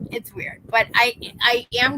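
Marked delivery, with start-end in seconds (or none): 0.1-0.6 whispered repeat. Real, not acted.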